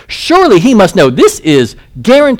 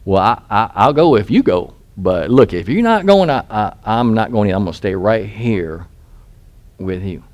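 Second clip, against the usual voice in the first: timid Barak; 6 semitones lower, 5 dB quieter than usual. Retold or imitated. Barak hesitating to go alone.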